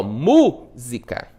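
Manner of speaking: música slowly split, strong mú first, then zica; stress on the first syllable. Shown as a demonstration. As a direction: punch the mú of música, then pause. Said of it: "pronuncio aí com uma maior intensidade". (música com ênfase pausada no mú)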